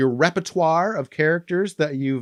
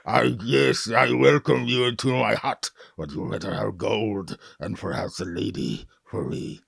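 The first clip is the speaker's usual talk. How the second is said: a hint of a greedy orc in his hut; 2 semitones lower than usual. Shown as a demonstration. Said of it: "I'm the orc".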